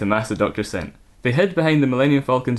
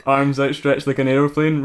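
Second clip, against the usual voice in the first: ordinary delivery, nothing marked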